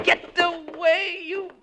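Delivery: high, slow, strained yell; shooing the bat. Acted. Announcer alone in the dark, yelling at a bat. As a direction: swat the bat away with a cry.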